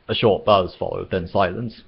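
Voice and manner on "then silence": plain reading voice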